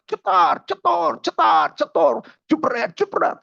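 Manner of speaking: high-pitched rhythmic lash noises; spoken belt-crack sound effects; imitated whip cracks. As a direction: act out each lash with a high vocal snap.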